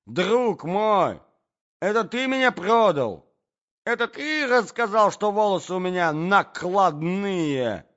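high, loud, wounded accusation; dismayed gentleman protesting loudly; betrayed prince. A narrator's reading. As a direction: cry out at a treacherous friend.

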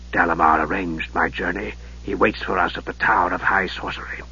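low, soft, sinister mage; Raistlin's voice. Acted. Raistlin greeting his nephew, low and softly sinister. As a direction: hush it like a frail dark wizard.

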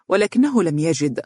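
ordinary delivery, nothing marked

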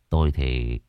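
grave master. Acted. Master's grave low verdict.

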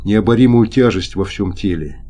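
plain reading voice

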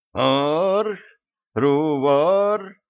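strange alien syllables, high; alien growl. Acted. Centaurian speaks alien sounds.

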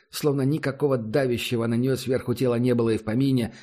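ordinary delivery, nothing marked

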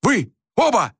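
high, curt shout; acted exasperated command; barked order. A narrator's reading. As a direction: bark at both to be silent. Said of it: "рявкнул Рюйс".

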